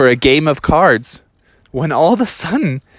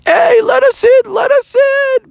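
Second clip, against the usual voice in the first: put-on high, loud kids' shouting; screaming woefully; 5 dB louder than usual. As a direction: yell to be let in, high and pleading. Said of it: "they all were screaming".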